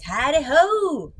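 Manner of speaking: drawn-out, swooping hare voice. Shown as a demonstration. Reader showing children how Hare talks, swooping character voice. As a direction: stretch it out into a loopy call. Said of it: "That's how Hare talks in my head".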